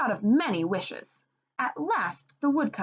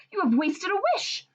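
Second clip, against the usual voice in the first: scolding; 11 semitones higher than usual. Acted. Wife scolding husband.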